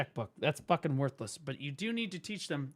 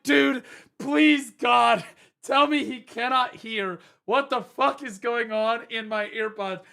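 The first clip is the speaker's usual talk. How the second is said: loud, higher, slow panicked plea; 7 semitones higher, 7 dB louder, slower than usual. Retold or imitated. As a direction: beg in rising alarm, loud and drawn out. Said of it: "And I'm like".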